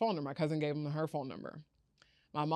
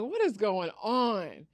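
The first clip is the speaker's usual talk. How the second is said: raised, drawn-out baffled question; stunned disbelief; 4 semitones higher, 4 dB louder, slower than usual. Retold or imitated. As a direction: stretch it out higher, in disbelief. Stunned at claimed brother, asks louder.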